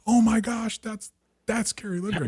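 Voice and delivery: put-on a kid recognizing his hero; starstruck gasp. Teen fan spotting the star onstage, high amazed gasp. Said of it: "And I was like".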